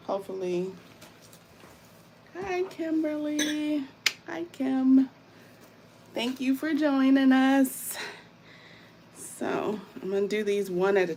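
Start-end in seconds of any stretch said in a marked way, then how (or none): 2.3-8.0 excited greeting. Real, not acted. Greeting viewer by name.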